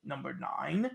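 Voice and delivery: lightly voiced low announcer saying "number nine"; a low, repeated "number nine" with a rising end; executive's voice. Mimicking the studio executive on the track, low and rising. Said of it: "the studio executive going".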